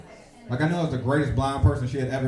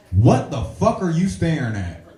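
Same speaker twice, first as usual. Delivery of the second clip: loud, slow angry snap; a fed-up woman snapping at the starer; angry girl; 5 semitones higher, 7 dB louder, slower than usual. Retold or imitated. Fed-up girl confronting the man staring at her, angry snap. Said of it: "she was like".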